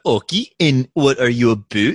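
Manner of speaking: exaggerated Canadian "okey" and "aboot"; mock Canadian accent. Host teasing co-host about posing as Canadian, mock Canadian accent. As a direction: round the vowels into cartoon Canadian. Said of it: "Did you walk around and talk about".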